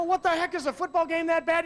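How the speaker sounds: playful loud incredulity; mock disbelief. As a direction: poke fun at a blubbering friend. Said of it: "I go".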